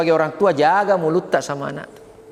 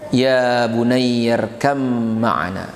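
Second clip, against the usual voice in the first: slow, low, drawn-out call to his son; pleading father's call; 7 semitones lower, much slower than usual. Acted. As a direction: stretch it out like a father calling from the ark. Nuh calls to his son. (panggilan ayah yang memohon, pelan dan panjang)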